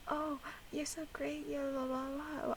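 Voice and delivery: high, soft mock flattery; sweet flattering voice. Reactor mimics a toxic partner's sweet talk, high and soft. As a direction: coo fake praise. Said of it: "the person's like".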